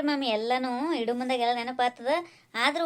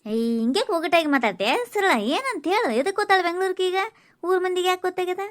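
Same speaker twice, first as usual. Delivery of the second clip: higher-pitched, insistent questions; impatient questioning; 6 semitones higher, 3 dB louder than usual. Acted. Aunt presses neighbour to explain the Bengaluru news, impatient. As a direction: demand a straight answer.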